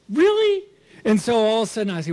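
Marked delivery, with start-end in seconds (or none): 0.1-0.7 high sharp disbelief; can't believe it's happening again; incredulous really. Retold or imitated. Man bumped by a boy, high disbelief. Kid hits him again.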